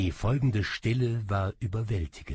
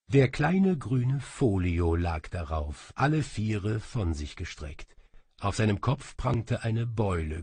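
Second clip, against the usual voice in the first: usual speaking voice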